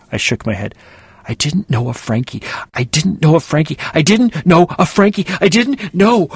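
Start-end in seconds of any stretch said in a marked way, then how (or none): 1.3-6.3 each repeat higher and louder, rising to near panic; stuck machine-like mantra escalating; from tense to frantic denial; repeating frantically. A narrator's reading. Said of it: "My voice repeated endlessly".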